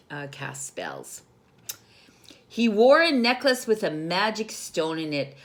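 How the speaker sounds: ordinary delivery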